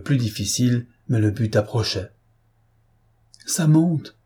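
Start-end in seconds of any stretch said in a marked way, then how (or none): 3.5-4.2 a choked, higher cry of panic; a hint of strangled terror. A narrator's reading. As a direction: cry it out with a tight throat as the water rises. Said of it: "cria Gourel d'une voix étranglée".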